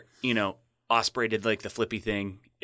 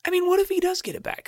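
excited. Real, not acted. Excited speculation.

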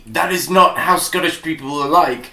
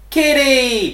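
put-on high, drawn-out childlike squeal; mock toddler exclamation; 7 semitones higher than usual. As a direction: imitate a delighted toddler spotting a cat. Mimicking the baby-man calling the cat.

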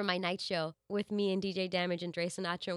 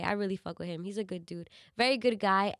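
normal talking voice

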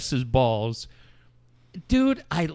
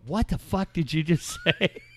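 laughing; 2 semitones higher, 4 dB quieter than usual. Retold or imitated. Laughing at friend's line.

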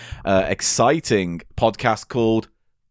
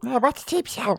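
mock audience voice; 10 semitones higher, 6 dB quieter than usual. Plays dumb audience member.

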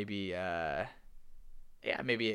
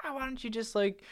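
coaxing dad; 13 semitones higher than usual. Retold or imitated. Dad coaxing her to sing.

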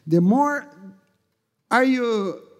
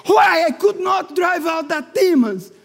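loud, higher desperate plea; anguished shout; 7 semitones higher, 5 dB louder than usual. Retold or imitated. Preacher retelling his plea to Jesus, anguished shouting. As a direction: cry out to Jesus demanding why. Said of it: "I said".

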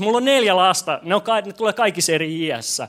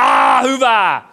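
loud call-out of approval from the congregation; shouted response; 2 semitones higher, 6 dB louder, much slower than usual. Shown as a demonstration. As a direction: shout it back like a cheering crowd. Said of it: "niin huudat vaan".

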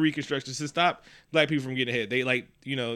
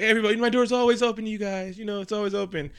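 higher, cheery school principal voice; elementary principal; 7 semitones higher than usual. Retold or imitated. Donald as a cheerful elementary principal, voice pitched up. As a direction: sell the open door like a principal. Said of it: "an elementary principal".

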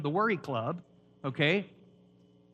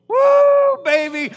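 excited whoop; 13 dB louder, much slower than usual. Real, not acted. Pastor thrilled by new insight.

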